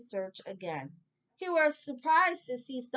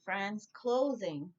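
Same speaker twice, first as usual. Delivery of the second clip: closing said slowly, leaning on the C; C word stressed; slower than usual. Shown as a demonstration. Teacher to listeners, slow stress on closing. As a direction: draw out closing to show the letter C. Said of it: "Another C word".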